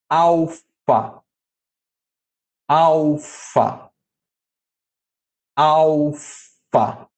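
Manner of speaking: Alfa said slowly three times, each with a falling tune; careful repetition. Shown as a demonstration. Teacher modelling the Greek letter names for the class. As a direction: model each syllable of Alfa for students to copy. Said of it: "Eu vou falar três vezes".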